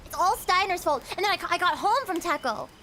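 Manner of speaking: ordinary talk, nothing marked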